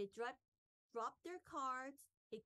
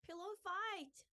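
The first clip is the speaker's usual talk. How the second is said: high, mildly raised cry; excited scream; 8 semitones higher, 4 dB louder than usual. A narrator's reading. Buster starts a pillow fight with friends, mild high scream. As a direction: call it out high, short of a full scream. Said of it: "screamed Buster".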